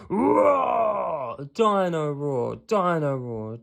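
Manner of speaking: loud, slow, hammed-up toy roar fading down; toy dinosaur roar. A narrator's reading. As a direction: boom out the toy's name like a robot monster. Toy comes alive at night.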